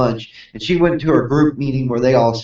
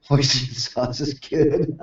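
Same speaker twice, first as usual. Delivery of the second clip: laughing; 8 dB quieter, slower than usual. Real, not acted. Joking about Hoisin sauce.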